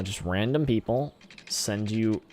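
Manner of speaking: ordinary talk, nothing marked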